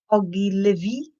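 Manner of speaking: ordinary delivery, nothing marked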